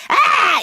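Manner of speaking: family suddenly going British; mock British exclamation. Patient mocking her family's sudden British greeting. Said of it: "They're like".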